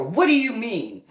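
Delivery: high, loud, indignant comeback; acted New Jersey temper flare; angry retort. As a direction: fire back with mock outrage. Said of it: "when you get angry".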